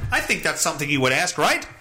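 ordinary talk, nothing marked

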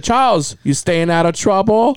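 imitated Chinese accent, name pitched high; Chinese doctor. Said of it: "my Chinese".

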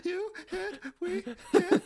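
high, tearful blubbering vow; mock-bawl the wedding vows; udawany płacz. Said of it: "I'm just gonna be like".

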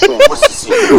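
eager, bursting question; excited high question. Acted. Actor asking a ripped buddy his secret, excited.

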